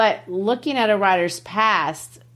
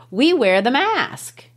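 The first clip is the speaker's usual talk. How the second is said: "We wear the mask" slow, raised, set apart; emphatic refrain; 5 semitones higher than usual. Shown as a demonstration. Teacher demonstrates the set-apart refrain, "We wear the mask" slow and emphatic. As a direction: lift "We" and "mask", spacing the words out. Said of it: "kind of saying it like that".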